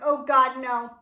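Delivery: mock freak-out with a loud burst. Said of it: "I'm, like, freaking out".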